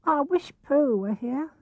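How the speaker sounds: slow, high, wistful little piglet voice; small lonely piglet, slow; lonely sigh. A narrator's reading. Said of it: "gave a very long sigh and said".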